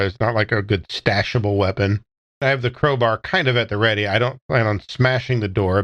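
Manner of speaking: ordinary talk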